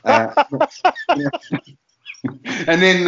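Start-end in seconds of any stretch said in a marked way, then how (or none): 0.5-1.6 a sudden high laugh bursting out; burst of high laughter. Real, not acted. Laughing at his own driving test story.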